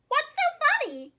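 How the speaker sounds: high goose voice asking; curious goose. A narrator's reading. Goose asking why everyone laughs, in a high voice. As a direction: ask in a high, honking goose voice. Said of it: "the goose wanted to know".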